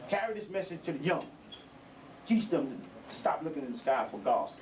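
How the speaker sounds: ordinary talk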